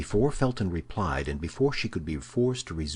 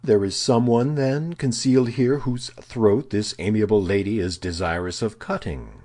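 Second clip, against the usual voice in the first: loud, high-pitched sarcasm dropping at the end; ironical contempt; 5 semitones higher, 7 dB louder than usual. A narrator's reading. Baron scoffing at the prisoner's wish for a knife, ironical and contemptuous. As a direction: hit it hard with mocking scorn. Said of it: "in an ironical, contemptuous tone".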